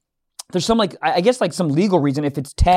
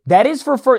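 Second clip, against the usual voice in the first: higher, insistent retort; getting worked up; 6 semitones higher than usual. Retold or imitated. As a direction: cut in with a firmer no. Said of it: "this is when it gets a little crazy".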